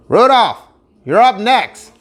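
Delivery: high, loud call across the field; coach shouting. Acted. Reindeer coach calling Rudolph to his turn, loud shout. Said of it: "the reindeer coach shouted".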